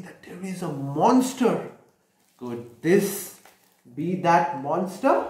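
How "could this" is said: plain reading voice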